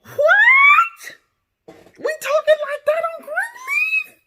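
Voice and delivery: high, loud, shocked shriek. Real, not acted. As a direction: scream it out in total disbelief. Shocked reaction, shouting.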